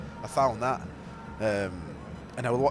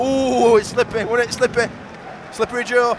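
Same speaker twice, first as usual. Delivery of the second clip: excited commentary; 8 semitones higher, 8 dB louder than usual. Real, not acted. Commentary continues.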